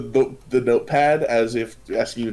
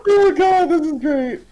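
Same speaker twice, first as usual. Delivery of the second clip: much higher, loud burst of delight; 6 dB louder than usual. Real, not acted. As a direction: gush with sudden joy at the scene. GM delighted by the table scene.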